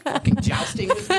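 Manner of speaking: laughing through the words. Real, not acted. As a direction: crack up while saying it. Laughing at the gauntlet remark.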